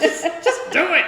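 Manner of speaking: high, loud, rapid frantic outburst; mock panicked outburst. To the dentist, begging him not to say it hurts: frantic, high, fast shout. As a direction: blurt it out fast in a hammed-up panic.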